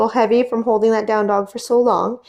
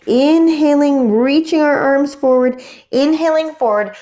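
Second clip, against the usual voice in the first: yoga cue call; 5 semitones higher, 5 dB louder than usual. Acted.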